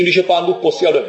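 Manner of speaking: usual speaking voice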